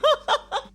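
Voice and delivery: high real laugh bursting out; a sudden laugh at herself; burst of laughter. Real, not acted. Reviewer laughing at her own reaction, high burst of laughter.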